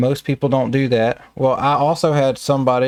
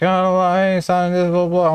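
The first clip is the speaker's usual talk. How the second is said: a hammed-up impression of the upset applicant; mocking the angry lady; 5 semitones higher than usual. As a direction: mimic her brushing off the paperwork. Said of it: "trying to fight me over it, you know, just like".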